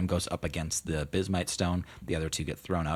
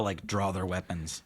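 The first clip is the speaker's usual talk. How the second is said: usual speaking voice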